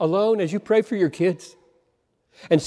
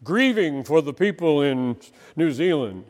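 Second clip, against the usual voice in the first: a touch of a sanctimonious tweet, read lightly; mock pious tweet; 5 semitones lower than usual. Preacher mocking a showy grief tweet, mildly sanctimonious. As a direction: lightly parody a showy online grief post. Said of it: "Beware of announcing your compassion".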